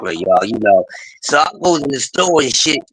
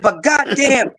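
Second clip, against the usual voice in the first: imitating woman; 7 semitones higher, slower than usual. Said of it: "She said".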